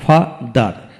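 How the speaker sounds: Father in two beats, fa, dar; split into syllables. Shown as a demonstration. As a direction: pause after ফা in father. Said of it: "দুটি syllable থাকে".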